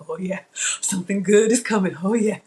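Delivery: lightly acted kids cheering for food; hint of gleeful kid anticipation; excited kids. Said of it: "when we were kids".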